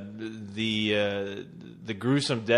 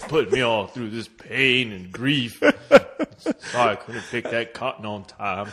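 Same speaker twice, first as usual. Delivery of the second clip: mock slave voice; 4 semitones lower than usual. Co-host teasing the host's voice, mock slave voice. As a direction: drag it out low in mock submission. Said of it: "is that your slave voice?".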